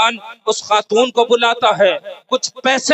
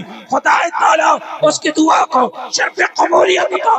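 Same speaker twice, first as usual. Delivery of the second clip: preaching at full force; 5 semitones higher than usual. Acted. Preacher telling crowds of her healing prayers, full cry. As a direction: build the miracle to a peak. Climactic preaching about the woman.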